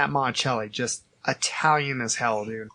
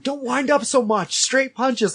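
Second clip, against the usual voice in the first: retold shouting; 9 semitones higher than usual. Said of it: "Matt shouting instructions".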